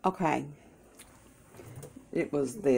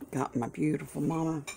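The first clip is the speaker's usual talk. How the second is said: ordinary delivery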